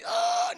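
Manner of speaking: high-pitched mock cry of pain. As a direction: yelp as if struck in the eye. Demon's eye is cut.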